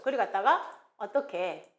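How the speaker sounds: ordinary talk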